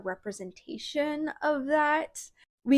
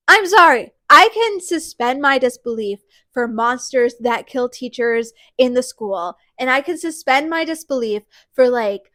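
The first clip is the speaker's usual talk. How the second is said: heated; 8 dB louder than usual. Real, not acted. Still heated after rant.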